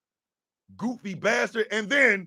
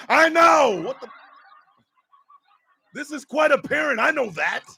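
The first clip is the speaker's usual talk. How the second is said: ordinary talk, nothing marked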